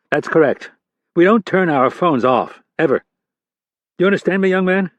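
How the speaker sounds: a senior director grilling a junior agent; stern FBI boss. Acted.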